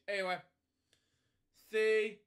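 ordinary delivery, nothing marked

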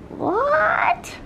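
high squeal of surprise; amazed what. Real, not acted. Vlogger seeing the hotel view for the first time, amazed. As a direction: gasp it out high and stunned.

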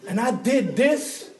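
high, loud, laughing pitch to win her over; gushing to his wife. Retold or imitated. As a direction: oversell the gift with a laugh in the voice. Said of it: "I'm like".

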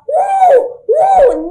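loud, high, drawn-out woo; stretched, whooping imitation; imitated helicopter noise. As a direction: mimic a child's whirring propeller with long loud woos. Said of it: "mimicking the helicopter's propellers, noise".

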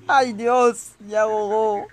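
ordinary talk, nothing marked

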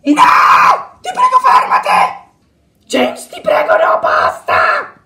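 strained, screamed plea; desperate begging. Acted. Victim begs James to stop, desperate shouting. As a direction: shout it, stretching the last word.

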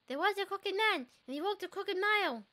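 lilting rhyme read with a slight lift; nursery rhyme reading. Acted. Player reading the rhyme aloud to the viewers, lilting. Reads the crooked man rhyme.

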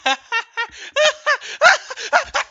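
loud, high burst of laughter; squealing laugh. Real, not acted. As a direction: crack up at yourself. Laughs at plugged-in remote.

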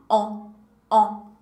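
"on" said with lips pushed forward, nasal. Shown as a demonstration. Teacher shows lips forward for "on". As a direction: pout and say "on, on". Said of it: "a boquinha pra frente".

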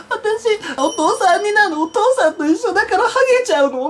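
high, slowed mock-shocked whine to mom; shocked plea. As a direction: act the worried child asking mom. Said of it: "すごいちょっと私がショックそうな顔でさ". (高い声でショックを受けたふりの訴え)

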